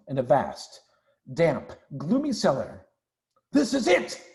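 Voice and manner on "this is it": higher, triumphant announcement; proud of the find, crying it out; excited fox crying out. Acted. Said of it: "cried Mister Fox".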